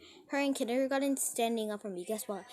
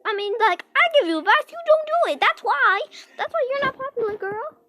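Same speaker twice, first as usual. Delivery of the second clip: loud, high scolding rant; sassy telling-off; 9 semitones higher, 9 dB louder than usual. Retold or imitated. Scolding the friend who won't take advice, loud and sassy. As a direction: tell her off loud and sassy. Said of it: "And I'm like".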